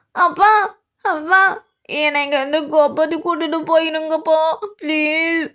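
begging to be taken home; desperate pleading. Acted. Daughter begs father from jail.

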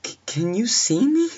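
very high, loud, stammered question; panicking. Acted. Babysitter asking the caller if he sees her, panicking.

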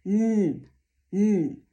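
Mm as a hummed "nnn" through the nose; nasal N. Shown as a demonstration. Teacher shows air forced through the nose, nasal "nnn". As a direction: block the mouth with the tongue and hum Mm as N. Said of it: "wydostać się przez nos".

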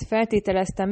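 ordinary delivery, nothing marked

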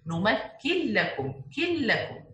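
كن لكم with no ن, one doubled ل; merge without nasal. Shown as a demonstration. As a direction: cancel the noon and stress the doubled laam in لكم. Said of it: "pronounce only one لام مشددة". (إدغام النون في اللام بلا غنة)

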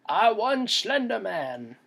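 high, slow triumphant declaration; playful boast pitched high; mock victory announcement. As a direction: announce the win grandly, stretched out. Imagined victory announcement for YouTube.

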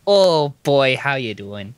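put-on playful Wisconsin accent; Midwest accent. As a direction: put on a folksy Minnesota accent. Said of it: "like Wisconsin or, or Minnesota".

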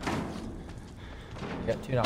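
slow hushed whisper; whispering while hiding. Real, not acted. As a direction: barely breathe the words out while hiding.

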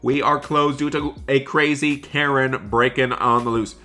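ordinary talk, nothing marked

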